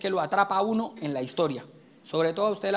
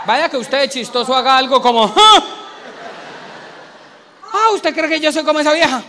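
much higher, louder indignant cry; outraged wife; 6 semitones higher, 9 dB louder than usual. Acted. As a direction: take offence loudly. Esposa indignada. (esposa ofendida, grito indignado mucho más agudo)

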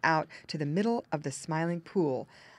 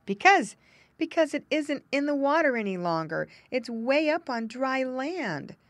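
mournful; 6 semitones higher, 5 dB louder than usual. Acted. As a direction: drag it out in a mournful muskrat tone. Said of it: "in the most mournful voice".

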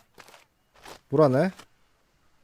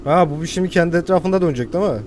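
ordinary talk

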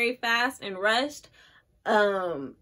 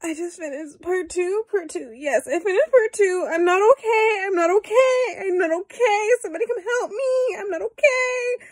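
high-pitched crying wail, getting louder; real tearful meltdown; 9 semitones higher, 4 dB louder than usual. Real, not acted. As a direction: plead through tears, overwhelmed. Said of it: "I wanna cry".

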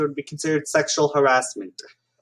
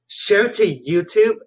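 higher, slow reading of the prompt; slow mock question; 6 semitones higher, 4 dB louder, much slower than usual. Real, not acted. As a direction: drawl it out like a doubtful question. Reads on-screen prompt.

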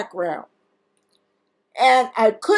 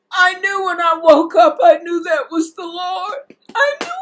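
real tears catching in the throat; tearful; 5 semitones higher than usual. Real, not acted. Weeping over God's touch in dream.

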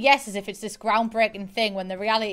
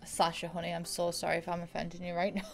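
ordinary talk

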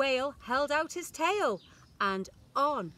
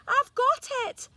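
high, loud excited cry; 14 semitones higher, 8 dB louder than usual. A narrator's reading. Snail struck by a plan, high excited cry. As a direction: burst out with the idea. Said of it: "she cried".

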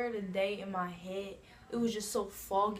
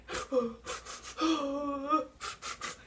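mock crying gasp; 6 semitones higher than usual. Family crying at farewell.

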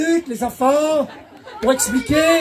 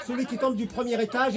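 ordinary talk, nothing marked

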